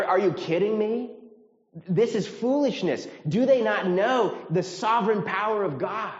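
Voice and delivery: loud, high-pitched, scandalized disbelief; mock incredulity. Preacher paraphrasing the psalm's scorn for raging nations, incredulous. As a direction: exclaim it as if the folly is absurd. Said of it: "It's saying, I mean".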